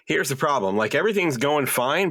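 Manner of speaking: ordinary delivery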